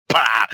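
loud, explosive mock laugh; re-enacted blast of gloating laughter; imitated laughter burst. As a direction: burst out with one big "bah!". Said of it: "let out a massive laughter".

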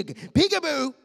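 put-on high, sing-out peekaboo as to a toddler; playful peekaboo. God playing peekaboo with his children, playful and high. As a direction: pop out with a high-pitched game call.

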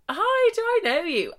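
high, coy mock-nervous hello; squeaky, self-conscious greeting; awkward greeting. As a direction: greet him in a flustered high voice. Awkwardly greeting the boy she fancies.

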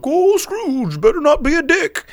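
high, loud goofy cartoon voice; hammed-up Goofy talking to Scrooge; Goofy scolding Scrooge in a high cartoon voice; Goofy impression. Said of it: "he's like".

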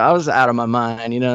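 ordinary talk, nothing marked

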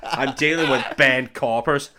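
slow Irish accent through laughter; mock Irish, drawn out and amused. Shown as a demonstration. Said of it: "for Irish".

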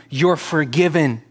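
ordinary talk

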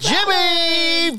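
slow high falsetto on the name. Acted. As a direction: drag it out in falsetto. Mock falsetto singing name.

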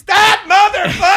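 high, drawn-out imitation of a furious shout; mad outburst. Comic imitating a colleague goaded about a rival, mad shout. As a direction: explode loudly, imitating someone set off. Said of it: "He was so mad".

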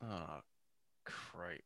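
very soft, half-whispered aside; under-the-breath letdown; hushed dismay. Real, not acted. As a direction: mutter it almost voicelessly as things go wrong. Co-host sees the stream froze.

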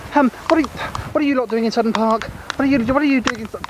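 high squeaky puppet voice; squeaky ventriloquist dummy. Acted. Narrator puppet speaks high.

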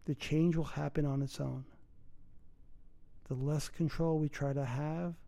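calm breathing guidance, unhurried; guided meditation. Acted.

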